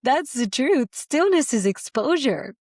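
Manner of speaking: emphatic declaration. Real, not acted. Preacher to her listeners: emphatic, high and loud declaration. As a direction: proclaim it with full conviction. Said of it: "emphatic repeat of her point".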